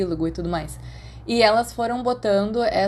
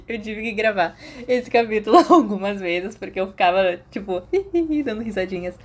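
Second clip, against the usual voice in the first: laughing while talking. Real, not acted. Reader admits she kept giggling while recording, laughing speech. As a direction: talk through the giggles.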